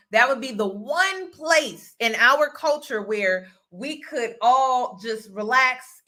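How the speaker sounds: usual speaking voice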